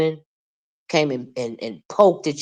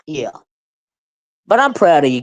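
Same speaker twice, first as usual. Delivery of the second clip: usual speaking voice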